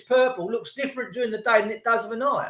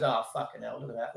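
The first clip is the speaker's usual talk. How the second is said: a low, hushed gasp of dismay; mock Queen, hushed; 9 semitones lower, 10 dB quieter than usual. The Queen sees the painted windows, hushed mock dismay. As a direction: drop the voice low in mock shock. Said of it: "She goes".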